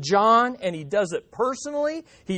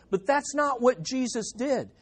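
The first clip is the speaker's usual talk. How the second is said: ordinary delivery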